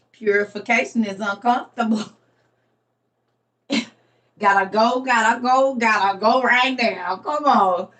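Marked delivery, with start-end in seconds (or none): none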